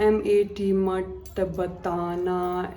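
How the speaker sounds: मत बताना said very slowly, each word apart. Retold or imitated. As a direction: spell out मत बताना one word at a time. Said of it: "एक एक word". (एक एक शब्द बहुत धीरे)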